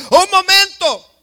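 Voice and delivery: agudo y fuerte, protestando; Moisés indignado. Pretend. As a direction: frena en seco, ofendido. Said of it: "para que Moisés diga". (Moisés reclamando indignado)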